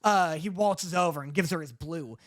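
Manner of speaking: usual speaking voice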